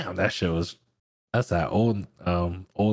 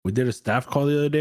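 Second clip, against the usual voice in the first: normal talking voice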